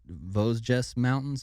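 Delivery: "Vosges" attempted as VOHZ-jess; unsure pronunciation. Real, not acted. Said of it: "I just have the hardest time pronouncing".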